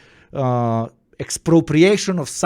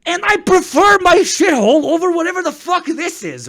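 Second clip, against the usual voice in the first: incredulous rant; 11 semitones higher than usual. Retold or imitated. Retold rant, high and far.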